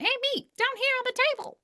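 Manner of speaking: put-on be the tiny food on the plate calling the kid over; talking food voice. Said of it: "who said that".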